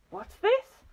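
high, loud gasp of shock. A narrator's reading. Mister Twit entering the upturned living room, gasping in shock. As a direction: gasp it out, voice leaping up. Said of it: "gasped Mister Twit".

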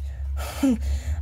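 quick breathy high laugh of relief; shaky relieved giggle; nervous laugh. Real, not acted. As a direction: laugh off the scare. Nervous laugh after fright.

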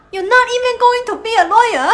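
laughing in disbelief. Acted. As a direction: laugh through the question. Mom laughs at son.

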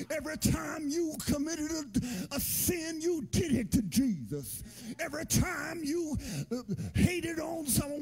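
preaching at full force, rising. Real, not acted. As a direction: preach it hard to the congregation. Preacher building to a shout.

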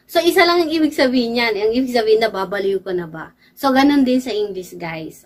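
ordinary talk, nothing marked

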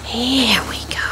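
soft whispered to the pet; hushed whisper. Real, not acted. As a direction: whisper it gently as you hand over food. Feeding the pet.

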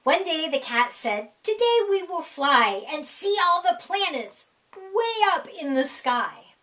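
ordinary delivery

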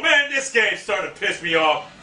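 ordinary talk, nothing marked

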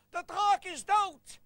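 slow, high, loud announcement; drawn-out victorious shout; triumphant proclamation. Acted. Knight proclaims his victory.

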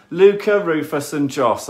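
calling names out loudly to viewers; loud shout-out. Real, not acted. Parent calling out names to the stream viewers, loud.

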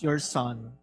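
normal talking voice

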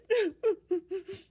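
high wailing sob; bear sobbing. Acted. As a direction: blubber like a sad bear.

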